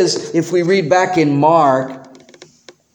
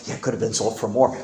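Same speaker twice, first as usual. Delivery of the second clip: much softer, fast muttered gossip; quick hushed grumble in the crowd; muttered agreement; 10 semitones lower, 8 dB quieter, much faster than usual. Retold or imitated. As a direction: mutter it to the next man. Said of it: "the other disciples are going".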